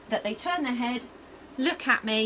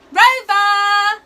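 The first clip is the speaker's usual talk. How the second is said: a huge, much higher urgent shout of the name; big loud call; 11 semitones higher, 16 dB louder than usual. Shown as a demonstration. Trainer shows the urgent recall call to her dog. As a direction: yell it like there's a fire. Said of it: "a big, loud voice".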